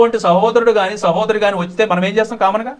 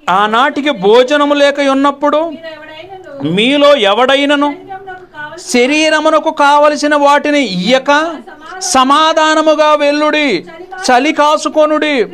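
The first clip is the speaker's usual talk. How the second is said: slow, high, loud scripture recitation; solemn scripture reading; 8 semitones higher, 4 dB louder, slower than usual. Acted. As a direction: intone the verses slowly like a preacher. Reads scripture aloud again. (నెమ్మదిగా, గంభీరంగా లేఖన పఠనం)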